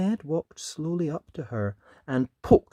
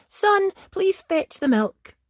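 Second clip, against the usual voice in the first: much higher motherly voice; Mum asking; 7 dB louder than usual. Acted. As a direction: ask sweetly like a mum. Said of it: "When Mum said".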